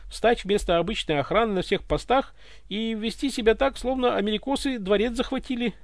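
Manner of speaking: high, anxious justifying; hasty explaining. A narrator's reading. Egor justifies himself to the tsar, hasty explaining. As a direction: explain yourself eagerly, pitched high. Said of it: "торопливо пояснял Егор".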